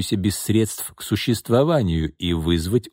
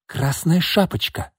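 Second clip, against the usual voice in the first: higher, slow triumphant cry; excited exclamation; 9 semitones higher, slower than usual. A narrator's reading. Woman cries out her idea to her partner, excited. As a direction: announce a brilliant idea. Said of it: "воскликнула Марина".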